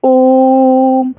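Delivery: teacher holding Om long; drawn-out Om. Shown as a demonstration. Teacher demonstrating a long drawn ओम for students. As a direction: stretch the ओम, the vowel held long. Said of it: "उच्चारण करते समय जहाँ पर लागल रहेगा".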